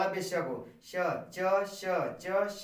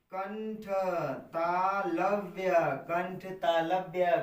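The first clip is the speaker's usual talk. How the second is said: slow drilling; much slower than usual. Shown as a demonstration. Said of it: "teacher drills the term slowly".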